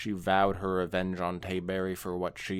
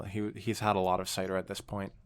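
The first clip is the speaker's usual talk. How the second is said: ordinary talk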